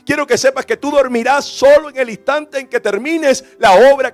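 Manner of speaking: loud, driving sermon delivery; full-force preaching. Acted. Preacher urging the congregation to choose service, forceful preaching. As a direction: press each promise home with force.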